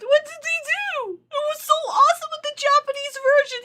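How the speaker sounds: high, anguished protest; tearful distress. Real, not acted. Fan lamenting the dubbed scene to viewers, tearful. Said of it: "I'm gonna cry".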